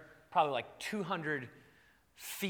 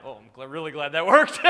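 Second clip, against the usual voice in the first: laughing relief; 4 semitones lower, 8 dB louder, faster than usual. Retold or imitated. Relieved aside after the dog stops.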